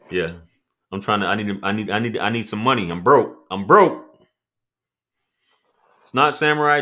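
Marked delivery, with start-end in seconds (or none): none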